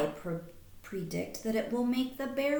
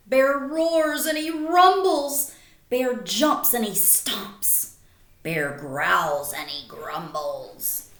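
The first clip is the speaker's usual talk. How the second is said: grumpy bear; 7 dB louder, slower than usual. A narrator's reading. Bear's angry waking.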